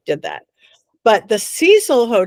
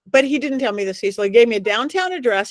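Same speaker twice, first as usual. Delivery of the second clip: ordinary talk, nothing marked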